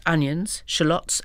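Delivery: usual speaking voice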